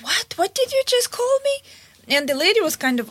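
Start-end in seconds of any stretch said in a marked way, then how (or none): 0.0-1.6 high-pitched, affronted question; indignant disbelief. Retold or imitated. Bus driver challenging the lady over an insult, retold indignant disbelief. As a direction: imitate an offended driver, voice shooting up.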